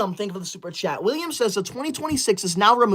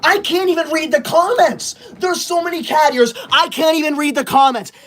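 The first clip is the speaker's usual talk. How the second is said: higher-pitched indignant wail; exasperated complaint; 4 semitones higher than usual. Real, not acted. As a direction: protest in mounting frustration. Complaining cat ears block comments.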